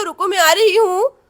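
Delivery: ordinary delivery, nothing marked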